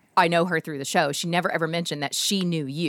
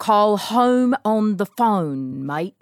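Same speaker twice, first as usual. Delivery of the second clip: mock Australian; 2 semitones higher, 4 dB louder, much slower than usual. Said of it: "That was even more Irish than".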